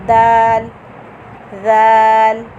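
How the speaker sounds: "दाल" soft and thin, loud and held; soft dal. Shown as a demonstration. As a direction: say "दाल" gently, not heavy. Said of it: "दाल नरमी से पढ़ा है मैंने". ("दाल" नरमी से, बारीक)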